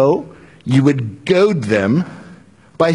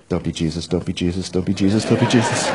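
much lower, much softer, repeated under the breath; muttered panicky pleading; 11 semitones lower, 5 dB quieter, faster than usual. Acted. Saul pleading to himself as Jesus appears, low mutter. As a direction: mutter it to yourself in rising dread.

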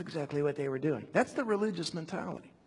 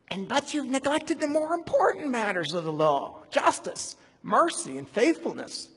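preaching at full force; 6 semitones higher, 6 dB louder than usual. Acted. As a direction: hurl the woe at the crowd from the pulpit. Reads Jesus' rebuke from scripture.

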